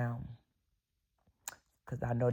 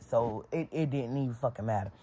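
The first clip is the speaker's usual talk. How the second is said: ordinary talk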